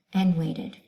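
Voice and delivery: plain reading voice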